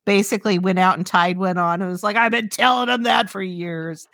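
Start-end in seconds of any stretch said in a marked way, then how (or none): 2.2-4.1 high giddy whoop; hammed-up tipsy celebrating; mock drunk cheer. Imitating Greer celebrating drunk.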